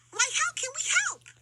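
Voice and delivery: high, fast eager little voice; an eager child offering help; young kangaroo. A narrator's reading. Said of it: "asked the young kangaroo".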